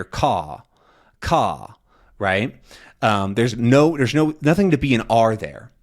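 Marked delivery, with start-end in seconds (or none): 0.0-1.8 "caw" with no R, British style; non-rhotic. Shown as a demonstration. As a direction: drop the R in car, "caw". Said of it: "you might hear".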